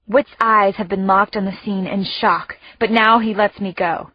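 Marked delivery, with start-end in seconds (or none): none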